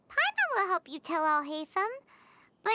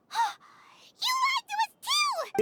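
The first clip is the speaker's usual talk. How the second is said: gasp, then a much higher indignant cry; shocked accusation; 13 semitones higher than usual. Acted. Paimon gasps and accuses Alhaitham of lying, shrill and shocked. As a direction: catch your breath and blurt it out. Paimon realizes she was deceived.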